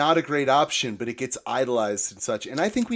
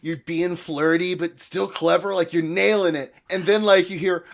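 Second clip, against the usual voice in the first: usual speaking voice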